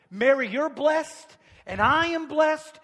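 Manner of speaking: loud, high declaration; ringing proclamation. Acted. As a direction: call out each blessing triumphantly. Preacher proclaims the blessings.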